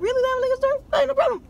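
high, incredulous retelling of his own reaction; surprised. Retold or imitated. Man retelling his surprise at a liquor store in Publix, high and incredulous. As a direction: squeak it out in disbelief. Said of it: "I'm like".

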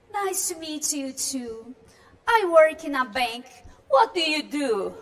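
slow, lightly acted party guest; Marianne reading. Teacher voicing Marianne at the party, slow and lightly acted. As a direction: read her lines slowly, like a polite guest.

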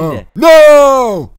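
loud, high drawn-out no; protesting cry. Acted. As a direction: wail it out in protest. Protesting at dinner news.

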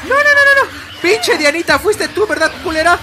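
panicked high screaming; being chased. Real, not acted. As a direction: shriek in real panic. Ambushed by enemies in game.

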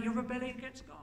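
normal talking voice

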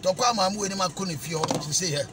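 ordinary delivery

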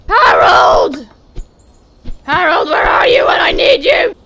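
loud, rising hammed-up wail; melodramatic curse. Lost bear cursing sky, trees and Harold in mock anguish. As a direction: shake your fist at the heavens, mock-tragic.

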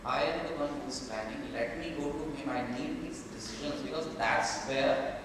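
usual speaking voice

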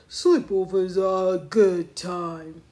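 loud, level-toned ape character; agreeable Norman voice. A narrator's reading. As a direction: agree in a put-on storybook voice. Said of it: "Norman agreed".

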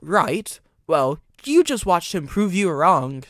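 loud, slow sneering comeback. A narrator's reading. Driver putting the mocking engine down, loud sneer. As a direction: lean slowly on every scornful word. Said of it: "sneered Charlie".

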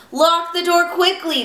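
high, urgent storybook cry. A narrator's reading. Pigs beg Little Bear to lock the door, crying out high. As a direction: cry out in high-pitched alarm. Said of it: "they cried".

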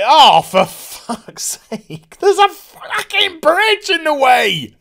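loud, drawn-out comic exasperation; exasperated yell. Acted. Heroine spots a bridge in the way, exasperated yell. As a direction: stretch the curses, rising to a high yell.

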